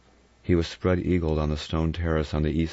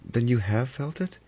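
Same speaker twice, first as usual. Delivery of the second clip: high, eager questioning; 8 semitones higher than usual. A narrator's reading. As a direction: seize on it eagerly, pitched up. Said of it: "Vera said eagerly".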